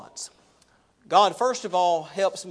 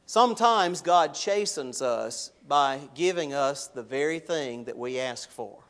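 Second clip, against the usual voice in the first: ordinary delivery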